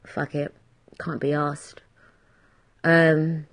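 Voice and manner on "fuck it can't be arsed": low, soft mock give-up; quiet fed-up. Quitting the podcast, mutedly fed up. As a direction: shrug it off under the breath. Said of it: "I might be just like".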